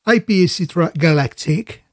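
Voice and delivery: reading the label in a put-on English accent; mock English accent, playful. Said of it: "je prends l'accent anglais". (accent anglais amusé)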